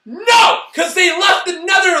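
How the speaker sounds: real frustrated shouting; exasperated shout. Real, not acted. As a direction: yell it in disbelief at the bad plan. Exasperated rant at Star Killer.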